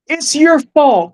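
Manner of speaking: high, slow tearful cry of blame; crying accusation. Retold or imitated. Police officer in a vision crying at the viewer, tearful. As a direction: weep the words out at him, pitch up.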